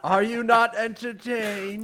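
slow, high, booming arena cry; Gladiator shout. Acted. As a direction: bellow it to the crowd. Quoting Gladiator as a joke.

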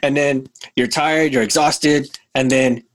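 ordinary delivery

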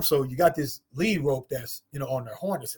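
normal talking voice

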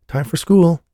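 loud high call. Acted. Mother calling her missing son for school, loudly. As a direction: call it through the house.

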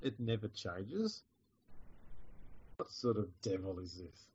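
faint, far-off voice; quiet mic. Real, not acted. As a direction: sound distant from the mic. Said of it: "You sound very quiet".

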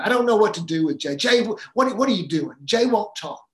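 loud, quick, fed-up mother; exasperated mom. Retold or imitated. Frazzled mother complaining to dad about her son, loud and exasperated. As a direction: vent in frustration at the boy.

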